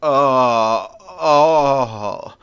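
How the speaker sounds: long acted groan of pain; loud moan. Penguin moans after landing on the dance floor, loud moan. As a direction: let out two drawn-out pained moans. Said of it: "lets out a loud moan".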